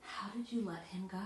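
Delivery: breathy whispered question. Acted. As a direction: let the refrain fall to a whisper. Repeated refrain in a poem.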